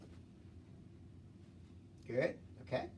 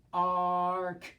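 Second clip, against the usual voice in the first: usual speaking voice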